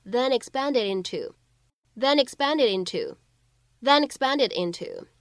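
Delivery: "then expanded into" read slowly, n and d linked into the next words; linking demo. Shown as a demonstration. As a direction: chain "then expanded into" into one smooth run. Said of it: "你其实可以读成". (慢速示范"then expanded into"连读)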